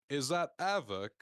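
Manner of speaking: hammed-up London accent from a Londoner impersonator. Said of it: "tried to imitate the London accent".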